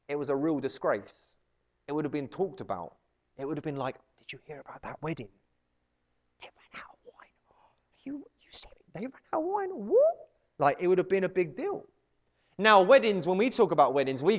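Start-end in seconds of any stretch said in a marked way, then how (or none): 4.2-9.7 hushed, scandalised whispered rumour; gossipy whisper. Retold or imitated. Wedding guests passing on the scandal, gossipy whisper. 9.7-10.3 high-pitched incredulous gasped question; mock shock. Wedding guest hearing the wine ran out, mock shock. Shocked gossip reaction retold.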